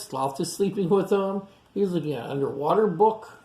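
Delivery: ordinary talk, nothing marked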